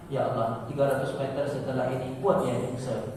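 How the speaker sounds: soft, mischievous mock prayer; quiet tongue-in-cheek prayer. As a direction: pray quietly with a sly wish. Joking prayer that rider crashes.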